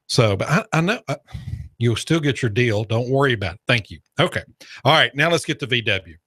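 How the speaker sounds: usual speaking voice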